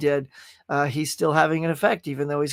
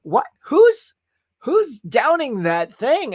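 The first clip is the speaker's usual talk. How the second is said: a sudden much higher "Who's", then a slow, drawn-out, baffled question; indignant disbelief; 7 semitones higher, 5 dB louder, much slower than usual. Retold or imitated. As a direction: act outraged and baffled at the thumbs-down voters. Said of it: "I'm like".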